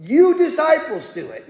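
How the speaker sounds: insist, hammering each word home; with emphasis. Retold or imitated. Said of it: "He says it with emphasis".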